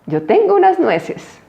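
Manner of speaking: high, slow, proud little cub voice; little bear cub. A narrator's reading. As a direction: sound like a small cub showing off his nuts.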